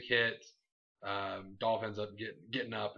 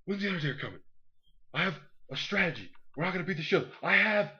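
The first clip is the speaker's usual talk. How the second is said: higher, eager and frantic voice; excited Daniel Bryan impression; 8 semitones higher, 4 dB louder than usual. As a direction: play him as an overeager planner, pitched up. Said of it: "It was just Daniel Bryan".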